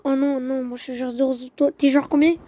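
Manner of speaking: normal talking voice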